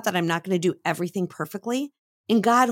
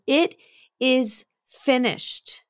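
a slow, rising cry drawn out high; a hint of a dying man's final cry; called out slowly; 5 semitones higher, much slower than usual. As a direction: call it out slowly, like a last cry from the cross. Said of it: "he called out".

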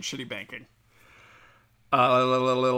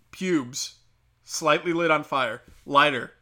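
ordinary talk

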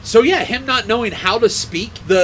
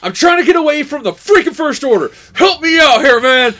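high, loud, hammed-up pleading; mock exasperated outburst building louder; imitated desperate plea; 7 semitones higher, 7 dB louder than usual. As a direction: plead for help, half-shouting in mock desperation. Acting out film character's desperate plea.

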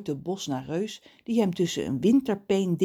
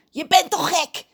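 loud, high, cawing crow voice; angry crow; 12 semitones higher, 7 dB louder than usual. A narrator's reading. Crow scoffs at John, high angry squawk. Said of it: "Kraai krast een boos lachje".

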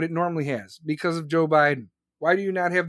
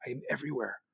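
repeated much lower and softer, almost to himself; hushed echo; 7 semitones lower, 11 dB quieter, much faster than usual. Shown as a demonstration. Echoing his own podcast brag, hushed and low. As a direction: drop it to a near-whisper echo.

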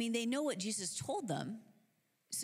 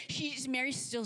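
ordinary talk

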